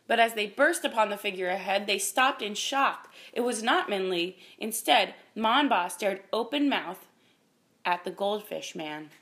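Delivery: ordinary delivery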